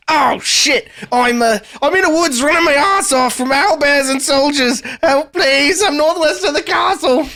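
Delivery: high-pitched, frantic cry for help; panicked rogue. Acted. Hunted rogue calling for help from the woods, high panicked voice. Game master voices endangered friend's reply.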